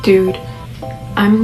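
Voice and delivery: ordinary delivery, nothing marked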